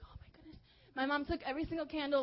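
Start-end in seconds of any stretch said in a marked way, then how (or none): 0.1-0.6 hushed dismay. Retold or imitated. Retold hushed dismay after scolding.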